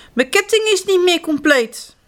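high, upset cry; alarmed. Acted. Spruitje realises the gold sprout is gone, upset. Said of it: "roept ze".

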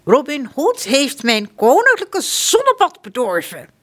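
high, loud indignant complaint; furious prince. Acted. Prince John fuming that Robin spoiled his bath, furious. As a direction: rage like a spoiled royal. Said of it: "prins John is woedend".

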